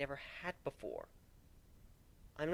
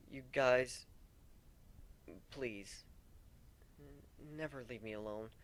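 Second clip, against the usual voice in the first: much softer begging; hushed plea; 10 dB quieter, slower than usual. Acted. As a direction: plead quietly, almost breaking. Tearful plea to friends.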